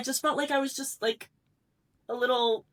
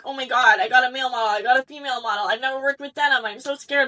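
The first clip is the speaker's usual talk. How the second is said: ordinary talk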